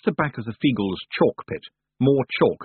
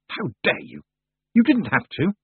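angry; 6 semitones higher than usual. Acted. Tiffany furious after slapping.

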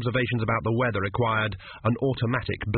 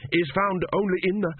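trailing off; 8 semitones higher than usual. Acted. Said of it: "Tepic's voice trailed off".

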